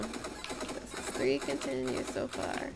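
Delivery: slow, soft whispered aside; whispering. Real, not acted. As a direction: whisper it to yourself slowly.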